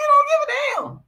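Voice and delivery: very high, loud squeal of disbelief; high-pitched derisive exclamation; incredulous squeal. Real, not acted. Incredulous repeat after laughing.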